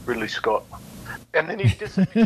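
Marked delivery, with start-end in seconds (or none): 0.1-0.6 lightly voiced, lower self-introduction; a hint of a director's matter-of-fact low intro; name dropped low and deadpan; Ridley Scott introducing himself. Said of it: "He goes".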